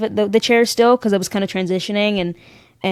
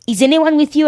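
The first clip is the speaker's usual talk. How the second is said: put-on French accent; 6 semitones higher, 3 dB louder than usual. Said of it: "Your French accent".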